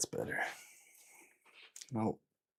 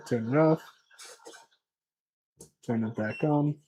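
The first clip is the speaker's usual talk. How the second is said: ordinary talk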